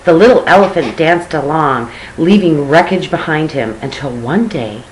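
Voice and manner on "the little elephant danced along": ordinary delivery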